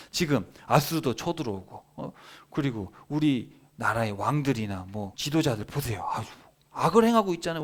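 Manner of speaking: indignant protest, leaping high at the start; acted exasperated complaint; arguing with God. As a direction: plead your case accusingly to God. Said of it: "하나님한테 따지는 거예요".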